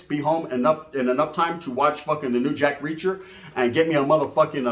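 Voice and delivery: normal talking voice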